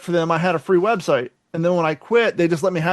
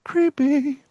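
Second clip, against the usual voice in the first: spooky; 9 semitones higher than usual. Acted. Spooky reaction to spam calls.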